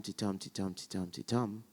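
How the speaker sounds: quiet steady ti-tum pulse; iambic beat. Shown as a demonstration. Showing actors Shakespeare's iambic beat, softly. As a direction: tap ti-tum evenly and softly. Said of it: "he'll give you ti-tum".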